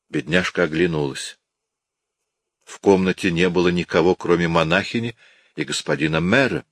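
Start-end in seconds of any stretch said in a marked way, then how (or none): none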